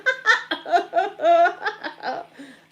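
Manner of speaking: loud, high imitated cackle; uncontrollable laughing fit. Granny takes over her body, uncontrollable mock cackling. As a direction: re-enact a possessed burst of laughter. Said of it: "I was like that".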